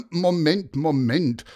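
interrupting. A narrator's reading. Paulus interrupts Pieper's questions, loud and firm. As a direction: cut in to stop the chatterer. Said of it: "unterbrach ihn Paulus".

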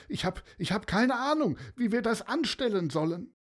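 the pilot admits he has no idea, hurried; despairing pilot. Acted. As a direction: rush through the helplessness.

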